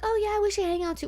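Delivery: high, breezy mock politeness; lightly mocking chirpy nicety; polite small talk. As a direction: toss it off like an empty promise. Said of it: "And we were like".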